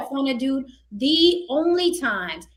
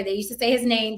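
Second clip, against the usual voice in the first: ordinary delivery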